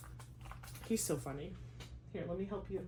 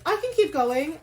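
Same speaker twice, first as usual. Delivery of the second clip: higher and louder, pushing on; pumped-up inner voice refusing to stop; eager self-talk; 8 semitones higher, 6 dB louder than usual. Retold or imitated. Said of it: "sometimes I'm just like".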